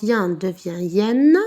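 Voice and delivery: "yen" nasal, then "yen" with a clear final N; teacher contrasting the endings; slow sound demo. Shown as a demonstration. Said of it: "I E double N E".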